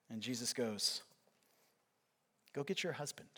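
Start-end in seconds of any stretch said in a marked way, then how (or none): 2.5-3.3 gentle quiet command. Acted. Jesus answers the woman.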